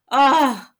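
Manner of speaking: a loud fed-up groan of disgust; disgusted ugh. Real, not acted. Groaning at the driver who cut in. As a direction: let out a sharp annoyed ugh.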